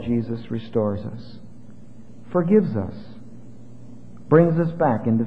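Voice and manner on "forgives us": ordinary delivery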